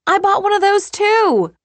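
very high, loud amused squeal; laughing outburst. A narrator's reading. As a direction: blurt it out in high-pitched amusement. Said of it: "I laughed".

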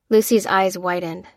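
ordinary delivery, nothing marked

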